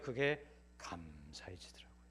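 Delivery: slow, breathless whisper; whispered gratitude. Real, not acted. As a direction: let it fall to a hushed whisper. Recalls turning grateful.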